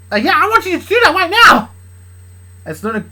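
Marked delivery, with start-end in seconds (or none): 0.1-1.7 high, loud insistent demand; heated yell. Acted. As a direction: shout the dare back. Heated acted quarrel, a dare.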